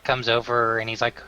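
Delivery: usual speaking voice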